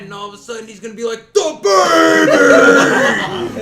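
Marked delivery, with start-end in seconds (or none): 1.3-3.6 huge mock scream of the baby's dad, very high and loud, drawn out. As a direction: scream the word as loud as you can, like a furious dad. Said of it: "screamed it so loud".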